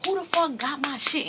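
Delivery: high, furious demand; angry yell. Retold or imitated. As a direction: yell it at the whole room. Demanding who stole her stuff.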